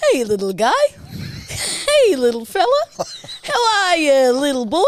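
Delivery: put-on high, loud hearty coo at a baby; blokey baby talk. Mimicking a friend greeting a mate's baby, high and hearty. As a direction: play the matey bloke cooing over a baby. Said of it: "Jarch goes".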